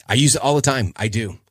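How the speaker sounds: usual speaking voice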